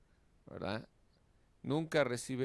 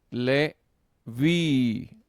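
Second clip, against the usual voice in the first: "Le vi" split in two, both loud and drawn out; teacher sounding out "Le ... vi" forcefully; syllable-by-syllable stress demo; 3 semitones higher, 10 dB louder than usual. Shown as a demonstration. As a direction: stretch "Le", pause, then a long loud "vi". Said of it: "Generalmente llevará el acento".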